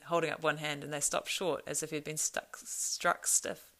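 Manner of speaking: ordinary delivery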